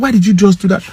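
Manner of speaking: high, heated demand; angry challenge. Retold or imitated. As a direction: press him hard in front of people. Confronting attendant as crowd gathers.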